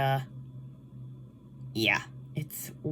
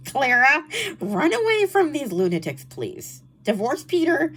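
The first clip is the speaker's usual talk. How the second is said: pleading outburst; 8 semitones higher, 4 dB louder than usual. Acted. Pleading with a book character.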